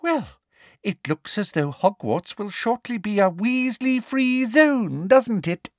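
high sugary gloat; gloating Umbridge. A narrator's reading. Umbridge gloats about a Weasley-free Hogwarts, sweet.

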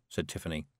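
ordinary delivery, nothing marked

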